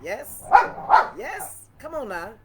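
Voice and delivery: high delighted yes, yes, come on now; excited reveal. Real, not acted. Unboxing the wine for viewers, high delighted squeals. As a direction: squeal at the bottle.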